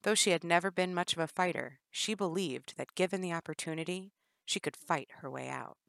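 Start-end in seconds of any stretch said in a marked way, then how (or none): none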